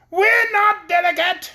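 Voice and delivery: very high, loud, slow tiny-soldier retort; retorting paper soldier. A narrator's reading. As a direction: bristle back at the insult. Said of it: "retorted another soldier".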